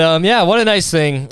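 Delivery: ordinary delivery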